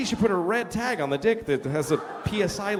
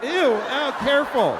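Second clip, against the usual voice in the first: squeamish wife; 7 semitones higher, 4 dB louder, slower than usual. Retold or imitated. Wife guarding furniture from mess.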